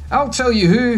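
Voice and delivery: high mock yell over the shoulder. Charlie yelling back while sprinting, mild yell. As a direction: call back while running, understated. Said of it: "he yelled over his shoulder".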